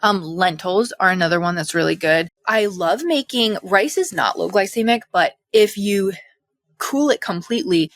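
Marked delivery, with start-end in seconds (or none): none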